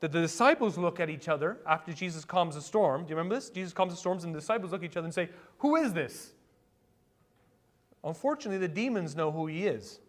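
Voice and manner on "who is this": high, astonished question, falling at the end; acted amazement. Preacher voicing disciples after Jesus calms the storm, amazed. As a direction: ask it in mock awe.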